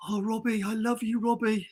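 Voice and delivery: mock-swooning fangirl; husband mimicking his wife gushing at a star, a touch of teenage fan; teenage fan mode. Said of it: "like, teenage mode".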